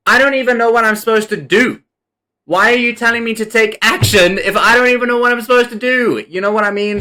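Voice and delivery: high exasperated plea; fed-up outburst. Real, not acted. Venting at the universe, high and exasperated. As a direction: complain at the universe. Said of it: "fed up with the universe".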